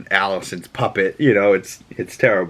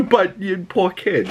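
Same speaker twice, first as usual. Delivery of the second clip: laughing pity; 10 semitones higher than usual. Real, not acted. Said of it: "laughing pity".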